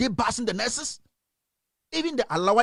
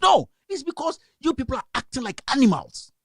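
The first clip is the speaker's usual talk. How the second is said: higher-pitched, indignant outburst; angry scolding; 5 semitones higher than usual. Real, not acted. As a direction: scold them in real anger. Scolding the audience angrily.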